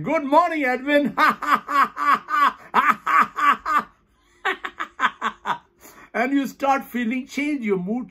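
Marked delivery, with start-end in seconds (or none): 0.0-1.1 high, exuberant good morning; cheery handshake greeting. Shown as a demonstration. Teacher demonstrates shaking hands with the host, exuberant greeting. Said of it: "you shake hand in the morning". 1.2-3.8 high, staccato forced laughter; long rhythmic peals of laughter; demonstrated hearty laugh. Shown as a demonstration. Teacher laughing through a handshake demo, high forced laughter.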